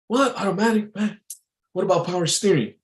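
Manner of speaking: put-on high-pitched, incredulous old-timer. Old timer at a bar quizzing a driver, incredulous old-timer voice. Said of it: "I had this old timer".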